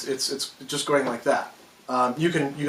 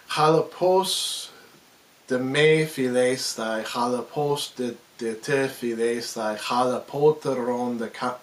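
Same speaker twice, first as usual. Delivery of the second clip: rhythmic verse recital; 3 semitones lower than usual. Shown as a demonstration. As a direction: recite an ancient Greek line in meter, lower. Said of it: "notice that it has a rhythm to it".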